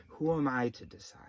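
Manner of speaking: mock whispered fretting. Participant whispering a self-excusing question, hushed. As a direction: breathe it as a hushed fret. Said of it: "unvoiced whisper".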